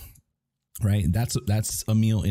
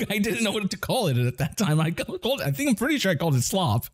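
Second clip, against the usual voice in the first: laughing through it; 11 semitones higher than usual. Real, not acted. Laughing while telling.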